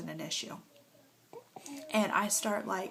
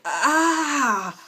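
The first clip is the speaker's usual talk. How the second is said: sharp cry of pain, high and loud; retold pain cry; 7 semitones higher, 9 dB louder than usual. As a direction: yelp as the contraction hits. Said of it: "And I was just like".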